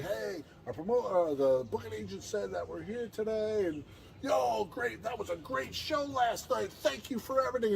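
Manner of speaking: high, overly cheerful thanks; fake innocent cheer. Acting innocent to the owner.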